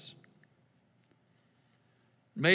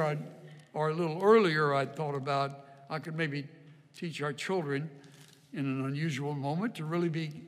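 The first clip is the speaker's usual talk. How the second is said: normal talking voice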